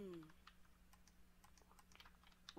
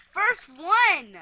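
loud, higher celebratory call; triumphant cheer; 6 semitones higher, 13 dB louder than usual. Real, not acted. Player celebrating first place, loud triumphant call. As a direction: crow it out proudly.

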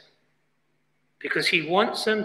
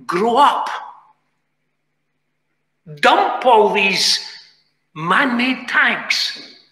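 slow, emphatic charge with raised pitch; preacher's exhortation; 4 semitones higher, much slower than usual. Acted. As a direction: exhort the church, stretching each word out. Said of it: "it's time to say to the church".

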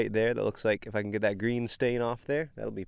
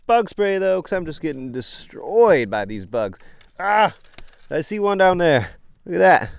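louder and higher, fired up; excited; 7 semitones higher, 10 dB louder than usual. Real, not acted. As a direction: exclaim it, thrilled. Bitten by bugs, excited.

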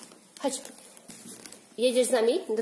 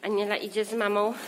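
ordinary talk

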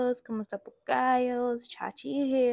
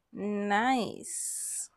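ordinary talk

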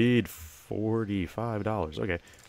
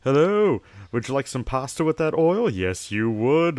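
high bright sing-song shop greeting, louder; cheery shopkeeper; 4 semitones higher, 6 dB louder than usual. Acted. Shopkeeper hailing a customer entering the store, cheery and loud. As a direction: welcome customers like an eager shopkeeper.

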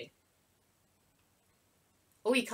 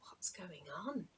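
awed whisper; 2 semitones lower, 14 dB quieter than usual. Acted. Child amazed, time stopped.

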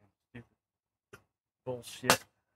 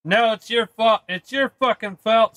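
angry blaming; 6 semitones higher than usual. Real, not acted. Blaming viewer for the death.

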